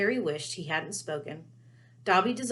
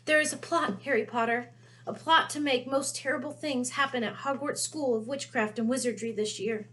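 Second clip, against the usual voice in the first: ordinary delivery, nothing marked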